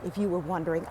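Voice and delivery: usual speaking voice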